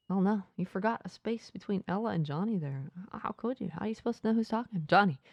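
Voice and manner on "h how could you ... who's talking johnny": ordinary delivery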